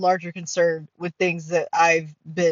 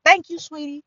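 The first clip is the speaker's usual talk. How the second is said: put-on thick West Side Cincinnati accent, higher-pitched; 6 semitones higher than usual. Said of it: "West Side of Cincinnati accent".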